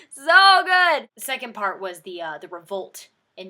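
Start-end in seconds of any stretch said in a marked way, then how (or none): 0.1-1.1 loud exclaim. Real, not acted.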